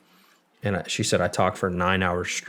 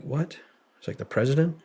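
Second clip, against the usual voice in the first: hushed, higher incredulous question; retold disbelief; 7 semitones higher than usual. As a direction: replay your stunned question, pitched up. Retelling own stunned disbelief.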